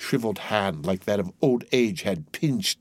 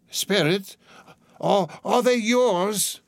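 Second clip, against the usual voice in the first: high, halting, appalled question; horrified stammer; 10 semitones higher, 3 dB louder, slower than usual. A narrator's reading. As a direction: barely get the words out. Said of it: "could say no more".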